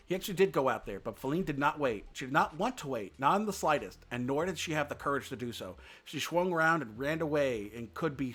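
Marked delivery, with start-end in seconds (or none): none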